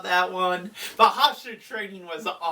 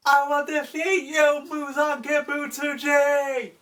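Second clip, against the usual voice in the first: higher, heroic determined cry; hero's vow; 8 semitones higher than usual. Retold or imitated. The hero swears to beat the demon king.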